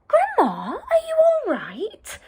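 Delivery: put-on high, breathy girl's call into the dark; anxious girl calling. Red calling to her grandma in the kitchen, high and anxious. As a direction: call out like a worried little girl.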